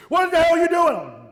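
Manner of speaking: high, loud yell of disbelief; a sudden high, loud yell; shouted demand. Retold or imitated. Angry shout at car driver.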